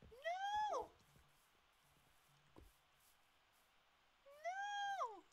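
very high panicked no; panicked scream. Real, not acted. Player losing items, very high scream. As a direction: scream in alarm.

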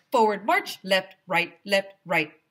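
loud marching commands; shouted drill. Acted. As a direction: drill the kids. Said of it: "shouted through his bullhorn".

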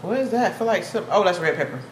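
chewing between words; talking around food; mouth partly full. Real, not acted. Seen 1: Took bite earlier; lips move chewing-like while she talks.

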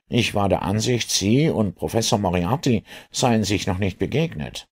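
high-pitched inspector voice; inquisitive inspector. Acted. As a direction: probe curiously in a high inspector's voice. Inspector's figure voice in story. (neugieriger Inspektor mit hoher Stimme)

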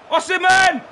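high, loud frantic cheering; excited shouting. Real, not acted. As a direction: roar him on toward goal. Cheering a striker through on goal.

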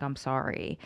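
low, soft and regretful; subdued apology. Retold or imitated. Friend to waiting partner, quietly sorry it fell through. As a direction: let the apology sink down quietly.

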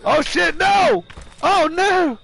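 high-pitched yell of dismay; alarmed cry. Real, not acted. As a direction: cry out in sudden panic. Sudden danger in the game.

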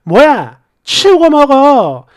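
loud, annoyed acted command; irritated. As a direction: snap at the husband, drawing out the order. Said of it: "또 짜증이 나서".

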